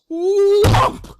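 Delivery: high, loud whoomp of a leap; big swooping landing noise; whoomp jump noise. Acted.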